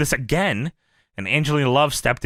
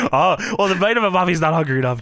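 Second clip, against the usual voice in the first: usual speaking voice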